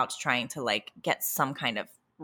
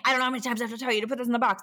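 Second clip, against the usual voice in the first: quick, higher-pitched retold nagging; exasperated scolding; 6 semitones higher, much faster than usual. Tired parent quoting own scolding at child over toys, exasperated. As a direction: rattle it off like a fed-up parent.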